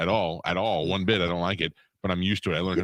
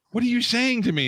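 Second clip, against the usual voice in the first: much higher confused question; baffled; 5 dB louder than usual. Retold or imitated. Answering the woman at the door, high and confused. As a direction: ask it totally bewildered. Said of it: "I go".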